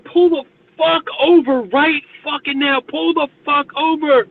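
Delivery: slow, high, loud retold scream. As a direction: yell at the fleeing cab driver to stop. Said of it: "I was screaming".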